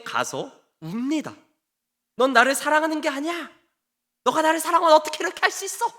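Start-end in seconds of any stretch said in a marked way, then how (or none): 2.2-5.9 high-pitched, hammed-up wifely complaint; mock tearful protest. Samson's wife reproaching him for not loving her, mock tearful. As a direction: plead as if crying, higher and hurt. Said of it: "라고 하면서 울어요".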